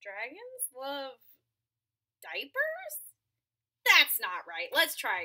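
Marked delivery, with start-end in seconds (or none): none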